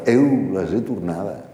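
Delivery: a lighter Venetian repeat, slow; a hint of Venetian singsong; Venetian dialect quote. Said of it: "en veneciano".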